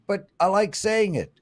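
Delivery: ordinary talk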